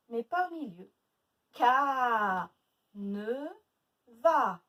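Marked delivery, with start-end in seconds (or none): 1.5-4.6 long loud CA, lighter ne, loud VA; stretched A syllables. Shown as a demonstration. Teacher recapping A first and last, stretched syllables. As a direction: lean on Ca and va, not ne. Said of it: "Au début et à la fin".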